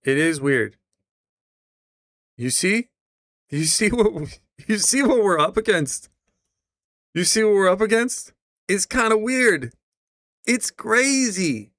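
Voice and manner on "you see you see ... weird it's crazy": high, loud amused disbelief; incredulous. Real, not acted. Streamer to chat about the clip, high amused disbelief. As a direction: marvel at it, pitched well up.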